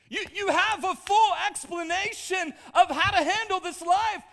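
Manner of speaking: high, very loud shouted sermon; excited, roaring revival climax; full-force preaching. Real, not acted. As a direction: preach it at the top of your voice.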